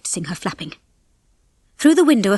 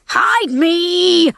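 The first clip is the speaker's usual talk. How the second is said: high, loud, drawn-out yell; 9 semitones higher, 5 dB louder than usual. A narrator's reading. Rocky begs again as danger nears, yelling. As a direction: yell desperately for cover. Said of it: "Rocky yelled again".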